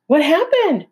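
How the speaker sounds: breathless, excited fox voice; panting. Acted. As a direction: gasp it out after running. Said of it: "the fox panted".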